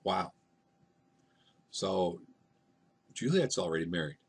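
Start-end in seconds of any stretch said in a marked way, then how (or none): none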